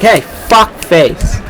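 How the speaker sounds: high, drawn-out insult hurled at someone; mock impatient call. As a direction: call out slowly to rouse a slow responder. Acts out snapping at slow people.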